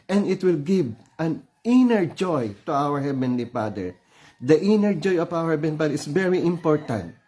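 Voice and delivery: ordinary delivery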